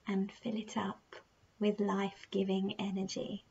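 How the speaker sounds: slow, soft meditation voice with unhurried, drawn-out phrases; guided meditation. Acted. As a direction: gently guide the listener through their breathing, leaving long pauses.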